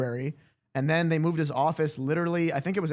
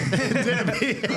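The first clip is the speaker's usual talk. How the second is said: laughing; 8 semitones higher, 3 dB louder than usual. Real, not acted. Laughing at own line.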